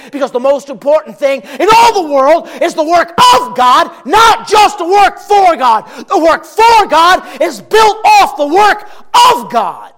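high, loud fiery preaching; preaching shout. Acted. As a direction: shout the warning to the congregation. Preaching at full force.

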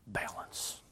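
unvoiced whispered last word. Real, not acted. Preacher ending his warning to the congregation, whisper.

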